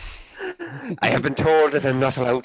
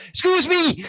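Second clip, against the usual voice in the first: outraged yelp, much higher; indignant. Real, not acted. Gamer protests being called a Ned, outraged high yelp. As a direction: leap up in mock offence.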